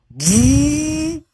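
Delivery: high, loud falsetto whoop; loud high whoop like a fan spinning up; PC fan whoop imitated. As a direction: mimic the computer roaring to life with a shrill woo. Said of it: "I just heard my P C go".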